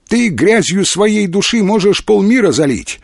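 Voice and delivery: high-pitched indignant reproach. A narrator's reading. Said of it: "возмутилась Вера". (возмущённо, с упрёком)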